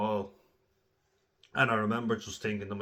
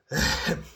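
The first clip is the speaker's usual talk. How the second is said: breathy laugh at the memory; burst of laughter; 7 dB louder than usual. Real, not acted. Laughing while telling the fight story. Laughing at own rough play.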